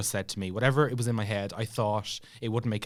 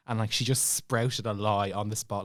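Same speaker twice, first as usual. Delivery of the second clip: ordinary delivery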